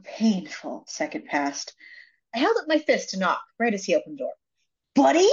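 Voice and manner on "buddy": a sharp, higher "Buddy?" thrown back at him; indignant. Retold or imitated. Woman at his door, offended he called her buddy, indignant. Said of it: "I said indignantly".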